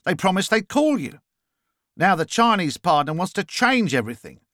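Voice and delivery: put-on Australian accent. A narrator's reading. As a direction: launch into the pitch in an Australian accent. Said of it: "noticing the Australian accent".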